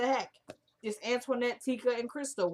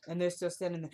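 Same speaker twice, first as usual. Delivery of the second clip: ordinary talk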